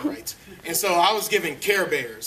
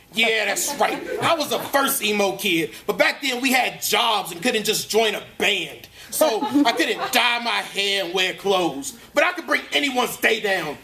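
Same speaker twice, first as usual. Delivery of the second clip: irritable cartoon bear telling his showbiz story, pitched higher; higher-pitched, put-upon storyteller; Grumpy Bear; 6 semitones higher than usual. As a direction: gripe through a jaded actor's memoir.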